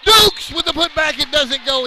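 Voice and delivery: high, loud shouted play call; excited shout. Real, not acted. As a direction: shout the miss like it matters. Shot and putback miss.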